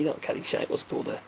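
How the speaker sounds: unvoiced, muttering under the breath; whispered mutter. Real, not acted. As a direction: whisper it to yourself while trying to remember. Muttering to himself, searching memory.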